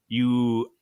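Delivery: usual speaking voice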